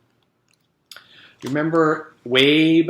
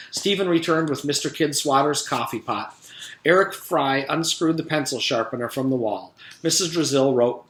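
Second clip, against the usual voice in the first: plain reading voice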